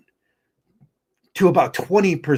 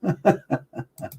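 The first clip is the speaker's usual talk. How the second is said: short laugh; 7 semitones higher, 4 dB quieter than usual. Real, not acted. Laughs after cursing.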